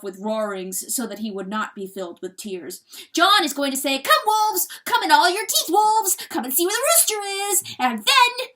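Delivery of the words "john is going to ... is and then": high, loud weasel bellow; full-force shouting, pitched way up. A narrator's reading. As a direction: bellow a summons to the wolves. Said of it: "the weasel was bellowing".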